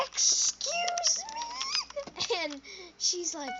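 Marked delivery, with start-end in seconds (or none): 0.1-2.4 very high, stretched-out mock outrage; hammed-up offended lady; outraged shriek. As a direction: draw it out in a scandalized squeal. Lady outraged at being flicked.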